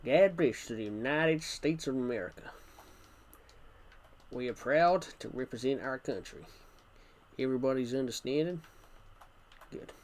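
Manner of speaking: put-on patriotic American accent. Shown as a demonstration. Blessing America for a viewer's request, hammed-up American accent. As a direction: address the nation in an imitation American accent. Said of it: "American accent now".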